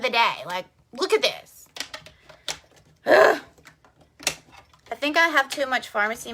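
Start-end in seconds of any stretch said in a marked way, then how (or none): 3.1-3.4 short, loud grunt of frustration; exasperated ugh. Real, not acted. Mom fed up with a bad day, exasperated ugh. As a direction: let out a sharp, loud "ugh".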